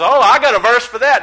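high, loud eager retort; eager comeback. Retold or imitated. Witness answering the preacher with his own verse, eager comeback. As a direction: jump in loud and high with a counter.

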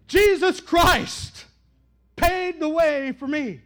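Jesus Christ stretched out slowly and loudly; slow emphatic declaration. Acted. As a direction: lean hard on each word, very slow. Climax answer to God.

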